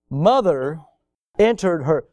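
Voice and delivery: ordinary delivery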